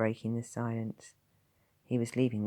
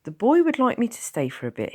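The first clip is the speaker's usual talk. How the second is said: calm; 11 semitones higher, 9 dB louder than usual. Acted. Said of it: "he replied calmly".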